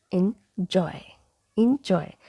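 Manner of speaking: "enjoy" split as in-joy; correct "enjoy". Shown as a demonstration. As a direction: model "enjoy" with short I first. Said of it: "So it is".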